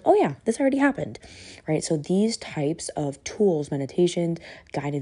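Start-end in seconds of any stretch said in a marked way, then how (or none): 0.0-1.2 high, quick acted realisation; relieved body's voice. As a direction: voice the body casually recognising it, pitched up and fast. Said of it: "'cause it's like".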